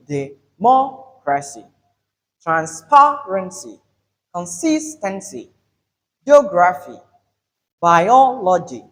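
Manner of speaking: "democracy", "transparency", "consistency", "geography", "biology" slow, third-last syllable punched; third-from-end stress. Shown as a demonstration. Teacher drills example words, stressed syllables drawn out. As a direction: stretch MO, PA, SIS, O, O in these words. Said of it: "Note this".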